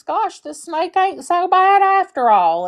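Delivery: high, light voice for the farmer's inner thought; high-pitched farmer thinking it over; farmer's pleased thought. Acted. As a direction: lift it up high, easygoing and relieved. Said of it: "the farmer thinks".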